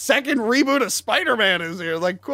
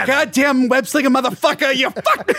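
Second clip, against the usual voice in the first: higher, hammed-up furious swearing; mock villain cursing; 7 semitones higher than usual. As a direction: curse out Spider-Man, cartoonishly. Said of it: "play the character".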